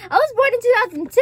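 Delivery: high, loud, incredulous outburst; shocked disbelief. Real, not acted. Realizing the movie predates own birth, shocked. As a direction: blurt it out high and fast, astonished. Shocked at the film's release year.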